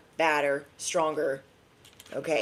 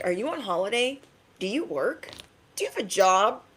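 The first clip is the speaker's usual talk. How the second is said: higher-pitched rising questions; mock puzzled onlookers; 4 semitones higher than usual. Reader mimicking people who look at you, mock puzzlement. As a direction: imitate nosy people quizzing someone.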